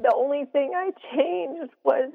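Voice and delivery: high, tight voice after crying; teary. Real, not acted. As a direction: speak on through held-back tears. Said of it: "sorry, I'm getting a little teary".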